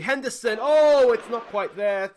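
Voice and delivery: rising excitement, then a let-down; excited. Real, not acted. Gamer calling a near miss, excitedly. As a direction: jump up as the chance goes begging. Goal chance in football game.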